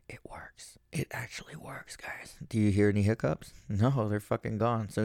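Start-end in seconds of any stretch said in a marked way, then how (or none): 0.1-2.4 breathless whisper, amazed the trick worked; excited whisper. Real, not acted. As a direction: hush it so the hiccups stay gone. Hiccups gone, testing quietly on camera.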